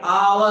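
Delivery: slow "आवर", starting on the vowel; silent H. Shown as a demonstration. Teacher showing students the sound, slow "आवर" with silent H. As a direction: stretch out "आवर" without any H. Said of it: "तो sound कब निकल रही है".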